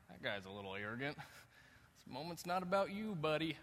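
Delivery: dry, mock-snide bystander voice, quick then slowed; Jim Gaffigan voice. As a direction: sniff at him like a smug onlooker. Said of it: "I sound like Jim Gaffigan when I talk like this".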